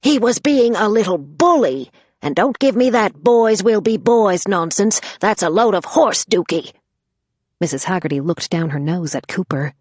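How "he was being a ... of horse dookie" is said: scolding. Acted. Grandma scolds the excusing mother.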